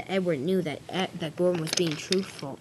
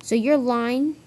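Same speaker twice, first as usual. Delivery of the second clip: raised, higher-pitched accusing question; furious accusation; 5 semitones higher, 6 dB louder, slower than usual. Acted. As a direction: confront him, a touch heated. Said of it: "Duck was furious".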